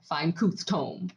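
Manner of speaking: "fine couth tome" slowly, K and T traded; slow, deliberate "fine couth tome"; swapped-sound demo. Shown as a demonstration. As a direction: say "couth" for tooth and "tome" for comb. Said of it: "That's how you'd say it".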